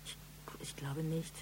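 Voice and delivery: soft, unsure whisper; nervous whisper. Acted. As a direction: whisper it barely audible. Nervous witness hesitates under questioning.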